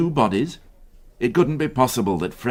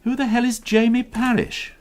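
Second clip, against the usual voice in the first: much higher, irritated question; annoyed disbelief at the trivia; exasperated demand; 12 semitones higher than usual. Acted. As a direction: inspector fuming over the daily report.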